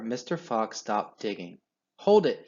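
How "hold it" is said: loud, high stop call; sudden halt. A narrator's reading. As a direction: halt everyone at once. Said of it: "he said".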